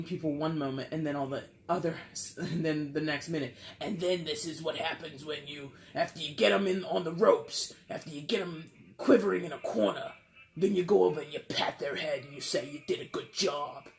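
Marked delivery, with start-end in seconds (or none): 3.8-13.9 loud, hammed-up New York accent; terrible New York accent. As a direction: lecture like a ruthless boss in a bad New York accent. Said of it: "this terrible New York accent".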